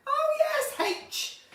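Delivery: light up at the news with a laughing squeal; thrilled to announce the guest, high and laughing; excited. Real, not acted. Excited about guest H from Steps.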